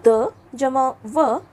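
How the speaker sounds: द जमा व drawn out piece by piece; very slow spelling out. Shown as a demonstration. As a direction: spell it out very slowly. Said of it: "अगला संयुक्त वर्ण देखते हैं". (बहुत धीरे-धीरे अक्षर जोड़ते हुए)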